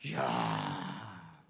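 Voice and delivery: drawn-out deep groaning 이야; long low sigh. Retold or imitated. Pastor recalling despair, long low groan. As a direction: let the breath sink out slowly.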